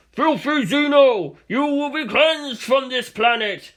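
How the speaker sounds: high, loud mock-heroic battle cry; badly accented shout. A narrator's reading. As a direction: bellow it like a zealous soldier in bad English. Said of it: "the office worker screamed in badly accented English".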